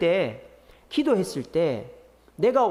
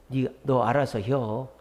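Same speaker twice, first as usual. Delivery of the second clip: slow, low, offhand Chungcheong drawl; Chungcheong dialect; 6 semitones lower, slower than usual. Retold or imitated. Chungcheong customer telling the seller to decide, slow low dialect. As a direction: lightly mimic a laid-back Chungcheong elder. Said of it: "충청도 분은".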